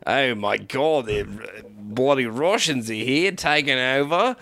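put-on Australian griping; Australian accent. Bloke sees Russians taking over, mock Australian accent. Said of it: "it's just all Australian".

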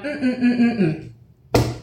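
smug rhythmic mm-mm hums; pleased humming. Retold or imitated. Archer pleased with herself in the rain, rhythmic hums. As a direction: hum along feeling yourself. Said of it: "I'm like".

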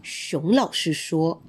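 plain reading voice

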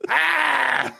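high, loud hammed-up scream; mock scream. Reenacting the mannequin scene for friends, hammed-up scream. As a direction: shriek in fake terror.

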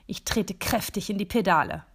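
usual speaking voice

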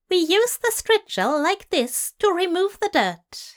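high, flustered fussy angel; flustered Aziraphale. Acted. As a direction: babble on, flustered and prim. Aziraphale voiced by narrator.

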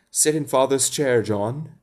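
outraged disbelief at the very idea; scandalized. Acted. Wendy shocked that John wants Father's chair, scandalized. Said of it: "Wendy was scandalized".

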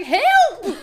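high, loud mock shout of help; mock cry for help. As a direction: yell it out, half laughing. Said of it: "I was like".

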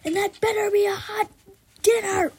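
loud, high hollered fast-food order; yelling. Acted. Jeff yelling his order at the drive-thru, loud and high. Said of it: "Jeff yells".

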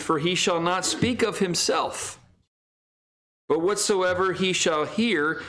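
climbing solemn scripture reading. Acted. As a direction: lift the words as Jesus's promise. Reads King James scripture aloud.

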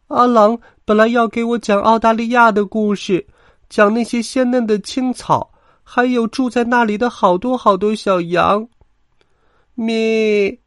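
very high, plaintive lamb voice; sad bleating. A narrator's reading. Little lamb misses the promised stories, sad bleating. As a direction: lament like a small lamb, ending in a bleat. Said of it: "小羊羔伤心地咩咩叫着".

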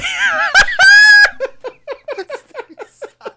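loud, high shrieking laughter; uncontrollable laughing fit. Real, not acted. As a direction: explode into a long squealing laugh. Cracking up at own joke.

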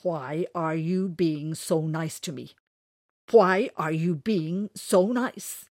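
slow, loud suspicious grumble. Acted. Winters questions JC's kindness, slow and suspicious. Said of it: "he muttered".

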